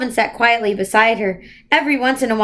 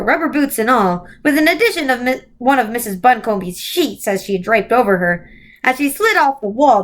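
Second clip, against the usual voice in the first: usual speaking voice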